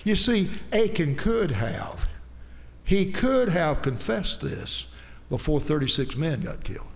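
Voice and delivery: usual speaking voice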